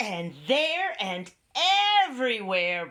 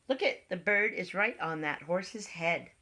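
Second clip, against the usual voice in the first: ordinary delivery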